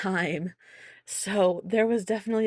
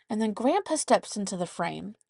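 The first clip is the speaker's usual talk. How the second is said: ordinary talk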